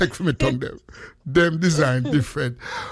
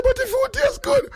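high squeaky quote of the wife; imitating his wife. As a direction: pipe it up like the wife defending her food. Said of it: "She said".

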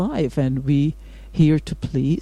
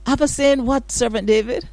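incredulous; 8 semitones higher, faster than usual. Real, not acted. Repeats the question, pitched higher.

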